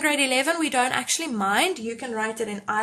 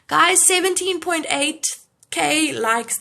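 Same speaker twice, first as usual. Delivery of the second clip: excited; 5 semitones higher, 7 dB louder, slower than usual. Real, not acted. Reacting to like count live.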